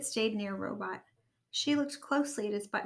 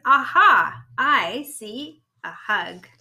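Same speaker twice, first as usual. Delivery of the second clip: loud, slow storybook robot; 11 dB louder, much slower than usual. Acted. Robot waking to see a hug, loud robot voice. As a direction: boom it out slowly like a waking robot.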